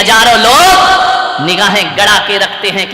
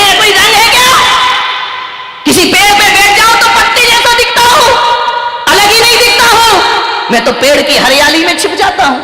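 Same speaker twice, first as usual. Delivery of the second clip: complaining parrot; 9 semitones higher, faster than usual. A narrator's reading. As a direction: whine like an unhappy parrot. Parrot complains about colour. (ऊँची तेज़ शिकायती तोते की आवाज़)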